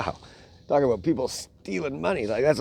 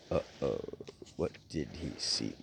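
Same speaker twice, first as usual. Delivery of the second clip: low, much softer suspense aside; suspenseful hush; 9 semitones lower, 12 dB quieter than usual. Acted. Dad pausing the story to tease his boys, low hushed voice. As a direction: drop it low to build suspense.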